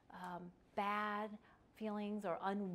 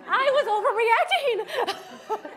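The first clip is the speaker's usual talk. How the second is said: very high, loud, slow mock child voice; hammed-up childish admission, played for laughs; contrite child; 13 semitones higher, 17 dB louder, slower than usual. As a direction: squeal it as an absurdly agreeable child. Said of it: "your child's not gonna say something like".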